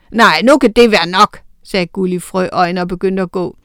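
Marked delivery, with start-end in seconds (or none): 0.1-1.4 lightly voiced troll. A narrator's reading. Troll ends argument.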